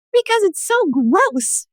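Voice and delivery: high-pitched mock whining teenager; whiny teen. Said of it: "people will come be like".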